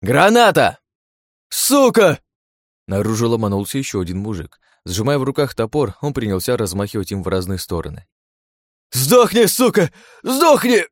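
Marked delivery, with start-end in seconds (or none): 0.0-2.2 loud high panicked shout; grenade warning yell. A narrator's reading. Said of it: "и крикнул". 8.9-10.9 screaming high and loud; furious shout. A narrator's reading. Axe man charging.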